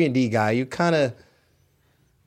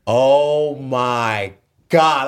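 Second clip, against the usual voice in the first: very slow, loud, drawn-out disbelief; stunned reaction; 3 semitones higher, 5 dB louder, much slower than usual. Retold or imitated. Player hearing he was traded, long drawn-out astonishment. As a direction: stretch each word in shock. Retold shock at trade news.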